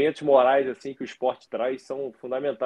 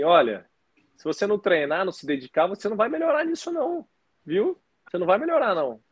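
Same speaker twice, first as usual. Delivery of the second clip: put-on high-pitched little girl voice lecturing her cousin, much higher; squeaky child scolding with a wagging tone; imitating daughter; 7 semitones higher than usual. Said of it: "falou assim". (voz aguda imitando a filha dando bronca)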